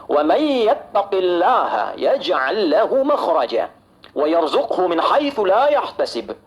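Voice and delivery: slow, drawn-out Arabic verse recitation; Quran recitation. Real, not acted. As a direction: recite the verse slowly, lengthening the vowels. (tilawah ayat Al-Quran yang pelan)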